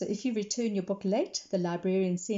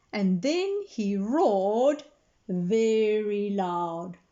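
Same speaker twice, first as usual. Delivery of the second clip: slow, stretched and louder; storyteller building to the roar; drawn-out dramatic narration; 3 dB louder, much slower than usual. A narrator's reading. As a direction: stretch each word for the big moment.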